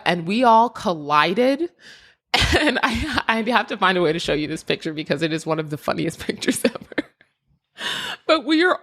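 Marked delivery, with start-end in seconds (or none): 2.3-8.8 talking quickly through real laughter; amused laughing. Real, not acted. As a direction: let the giggles break into the words. Amused recalling the funny picture.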